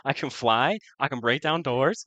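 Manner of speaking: high, loud, laughing excitement; elated boasting. Real, not acted. Player boasts of superpowers after a lucky roll, elated. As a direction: revel in newfound powers.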